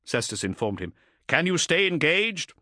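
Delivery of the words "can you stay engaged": stern voice over the vox; a captain giving orders; commanding captain voice. Acted.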